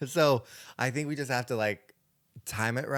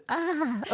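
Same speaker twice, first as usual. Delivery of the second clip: soft, very high "ah"; a little high sigh of a noise; after-fart noise; 12 semitones higher, 6 dB quieter than usual. Retold or imitated. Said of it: "Like, after I fart".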